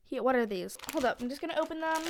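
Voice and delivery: ordinary delivery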